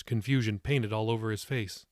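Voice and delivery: usual speaking voice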